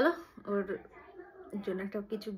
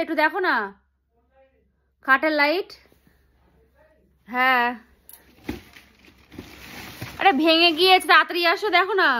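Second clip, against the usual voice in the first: loud higher urgent calling; 7 semitones higher, 19 dB louder than usual. Acted. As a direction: shout like the light really broke. Mom calling husband, fake emergency.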